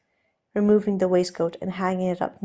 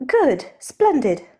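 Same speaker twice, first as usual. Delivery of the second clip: raised, lighter voice for the nervous aristocrat; Steed, flustered gentleman; 6 semitones higher, 7 dB louder, slower than usual. Acted. Steed offering to undo Ed's cravat, nervously polite. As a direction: play Steed, polite and a little jittery.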